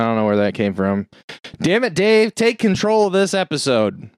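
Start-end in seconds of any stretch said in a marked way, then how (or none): 1.6-4.1 high-pitched, hammed-up scolding; mock exasperation. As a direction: play at being fed up, pitched well up. Mock scolding to steer show.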